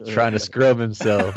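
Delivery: usual speaking voice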